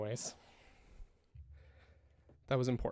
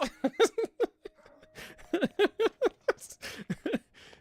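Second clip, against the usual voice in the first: high loud cackle; 9 dB louder than usual. Real, not acted. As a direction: burst out laughing. Big laugh.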